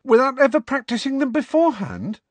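high, loud disbelief; incredulous squeak of a pupil; Parvati, incredulous. A narrator's reading. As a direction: question it as if you can't believe it. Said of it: "said Parvati incredulously".

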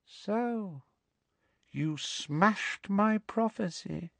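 high, hushed, slow villain voice; quiet, chilling Dark Lord; soft, cold menace. A narrator's reading. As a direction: say it very softly, with menace. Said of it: "said Voldemort softly".

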